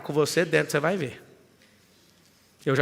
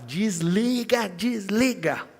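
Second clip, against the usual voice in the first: urging from outside; 5 semitones higher, much slower than usual. Retold or imitated. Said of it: "E eu do lado de fora".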